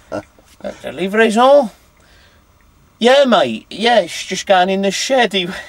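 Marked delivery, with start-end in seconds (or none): 0.1-1.7 loud, high attempt at French; tentative French accent, pitched up; best French. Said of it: "in your best French". 3.0-5.3 loud, high, rough go at Barnsley; reply like a Barnsley bloke, pitched up; not-very-good Barnsley accent, raised voice. Retold or imitated. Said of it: "I don't do a very good Barnsley accent".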